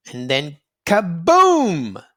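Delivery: slow, loud kaboom like a blast; booming kaboom. A narrator's reading. Narrator telling kids the tunnel blew up, booming. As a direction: draw it out big and loud, like an explosion. Tunnel explosion in kids' story.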